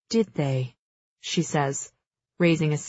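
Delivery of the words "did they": cool scepticism. A narrator's reading. Queen sceptically questions the witness.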